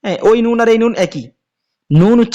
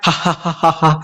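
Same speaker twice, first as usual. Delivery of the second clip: mock laugh; 4 semitones lower than usual. Shown as a demonstration. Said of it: "এই হাসি দিলে যে আওয়াজটা".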